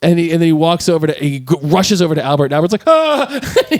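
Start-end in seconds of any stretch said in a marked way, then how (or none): 2.9-3.3 high, hammed-up "ah" of alarm; mock shocked yelp; startled gasp. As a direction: imitate a startled cry, pitched way up. Imitating startled Albert in retold scene.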